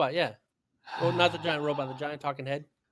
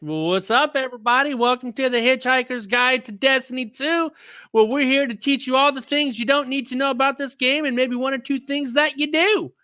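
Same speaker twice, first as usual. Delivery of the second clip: loud, high, over-the-top host greeting; screaming hype intro; 7 semitones higher, 6 dB louder than usual. Acted. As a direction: belt out the show opening at full pitch. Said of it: "some guy who screams a lot".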